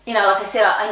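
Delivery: ordinary talk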